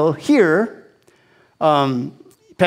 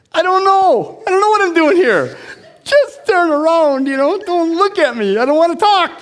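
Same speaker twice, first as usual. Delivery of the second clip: uncomfortable panic; 11 semitones higher than usual. Retold or imitated. Answering the pastor, high panicky nervous pleading. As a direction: plead high and shaky to be left alone. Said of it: "oh, I'm so uncomfortable".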